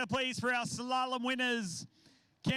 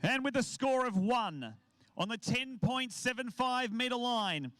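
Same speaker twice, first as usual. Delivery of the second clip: announcer. Acted. Announces award winners.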